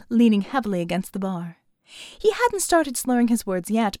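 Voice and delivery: ordinary delivery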